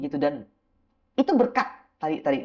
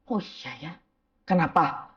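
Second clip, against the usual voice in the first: normal talking voice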